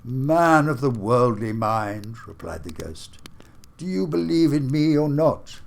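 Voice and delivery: a booming ghost demanding an answer. Acted. Marley's ghost challenges Scrooge, solemn and booming. Ghost speaks in read story.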